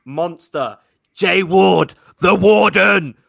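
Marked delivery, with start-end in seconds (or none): 1.1-3.2 loud, punched fan hype; real full-volume hype-man shout. Real, not acted. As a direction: roar the player's name with pride. Hyping his team's star players.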